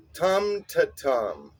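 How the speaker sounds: slow "tum to tum", both "tum"s long and weighted, "to" light; stressed-unstressed-stressed. Shown as a demonstration. Songwriting teacher showing a rhythm pattern, stress on both "tum"s. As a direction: lean on each "tum", skip lightly over "to". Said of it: "So stressed syllable, unstressed, and then a stressed".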